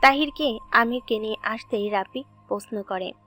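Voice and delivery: ordinary talk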